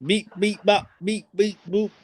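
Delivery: high, beeping robot noises. Retold or imitated. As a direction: beep it out like a glitching robot. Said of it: "it keeps going into a robot thing".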